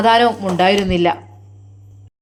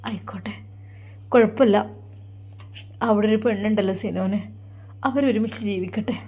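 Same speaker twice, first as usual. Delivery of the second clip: put-on quiet, softer voice of a hurt young wife, close to tears; soft tearful sobbing; 7 dB quieter than usual. Grieving young wife tells her mother softly, through tears. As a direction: let it come out hushed, as if holding back sobs. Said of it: "അവൾ തേങ്ങിക്കൊണ്ടിരിക്കുകയാണ്".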